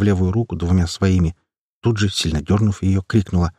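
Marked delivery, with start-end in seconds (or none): none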